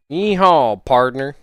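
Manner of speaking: loud, high yeehaw falling into a twangy partner; cowboy yell. Acted. Streamer reading a viewer's message as a loud, high cowboy. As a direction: holler it like a rodeo cowboy. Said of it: "Man even put the accent into his text".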